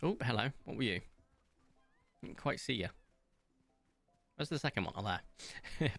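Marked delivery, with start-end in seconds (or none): none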